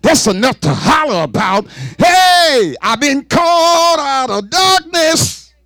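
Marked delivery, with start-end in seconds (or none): 2.0-5.5 high, slow shout of joy; exultant voiced believer; jubilant cry. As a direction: cry it out like a saved soul rejoicing. Voicing a rejoicing believer.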